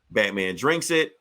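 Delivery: usual speaking voice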